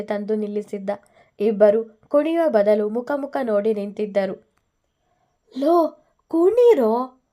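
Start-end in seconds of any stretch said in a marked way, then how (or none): 5.6-7.2 calling out. Acted. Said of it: "ಎಂದ ವಿನು".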